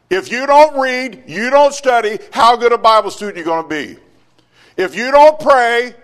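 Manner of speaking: loud, high preaching; sermon at full force; fiery preaching. Acted. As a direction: press the congregation hard.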